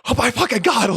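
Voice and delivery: high, loud, laughing shock; shocked waking cry. Retold or imitated. Waking up freezing in the shade, loud laughing shock. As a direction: jolt awake in the cold shade. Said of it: "I woke up like".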